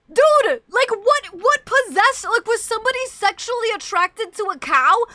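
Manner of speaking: high, loud incredulous ranting; shouting mock outrage at milk drinkers; loud outraged rant. Real, not acted. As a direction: rant in disbelief. Outraged rant about milking cows.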